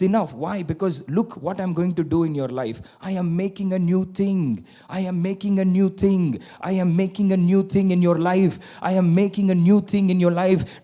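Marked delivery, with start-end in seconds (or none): 3.0-10.8 rising preached proclamation. Acted. Preacher proclaiming God's new thing, louder. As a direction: build the promise louder each time.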